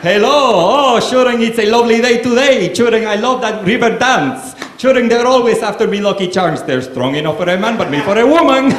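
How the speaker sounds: high, loud Cuban mangling of an Irish brogue; Cuban-Irish accent. Retold or imitated. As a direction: belt out a hammed-up Irish lilt through a Cuban accent. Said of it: "like Ricky Ricardo got cast in an Irish Spring commercial".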